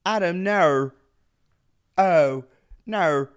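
loud mock-Australian 'no' with an R; attempted Australian accent. Comedian testing the one word he knows, Australian accent. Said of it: "only word I could say in Australian speak".

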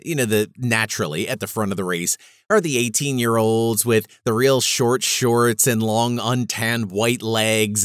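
ordinary talk

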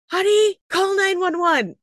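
high, slow, urgent plea to her husband; telling husband to call nine one one, high and urgent. Retold or imitated. Feels baby's head, calls husband.

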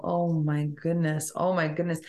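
ordinary talk, nothing marked

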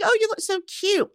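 high, mock-gushing compliment. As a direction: coo at her like everyone does. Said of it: "Everyone's like".

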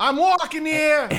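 loud, slow, high New York shout; New York accent. Retold or imitated. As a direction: belt it like a Brooklyn cabbie in a New York accent. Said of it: "then they could just do a New York accent".

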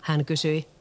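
usual speaking voice

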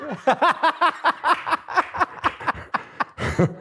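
long high-pitched laugh; delighted high laughter. Real, not acted. Laughing at own story.

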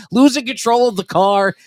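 ordinary talk, nothing marked

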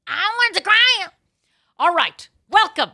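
high, loud announcer intro; podcast announcer. Acted. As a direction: open the show like a big announcer.

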